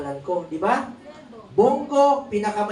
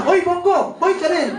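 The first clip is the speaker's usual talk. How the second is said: higher and louder playful yelling; fan's shout; 8 semitones higher, 5 dB louder than usual. Retold or imitated. Fan yelling back at the senator, playful shout. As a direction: call out cheekily, laughing at the end. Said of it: "Sabi niya".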